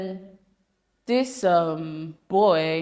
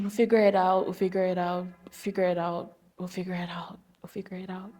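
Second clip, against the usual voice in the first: repeated, softer each time down to a whisper; fading mantra; 5 dB quieter than usual. Acted. A self-reassuring mantra about life fading into a whisper. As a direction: let it fade away into a whisper.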